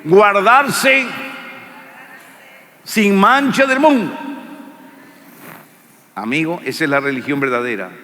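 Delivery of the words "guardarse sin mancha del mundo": high, loud lead line for the crowd to repeat; preacher leading the congregation in repeating; call and response. Shown as a demonstration. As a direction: belt it out so they say it with you. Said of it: "diga conmigo".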